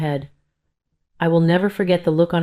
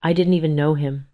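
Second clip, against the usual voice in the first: usual speaking voice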